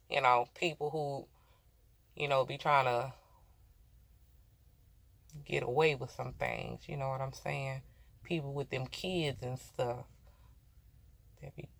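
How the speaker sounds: quiet knowing aside; hushed insinuation. Real, not acted. Hinting about hiding money.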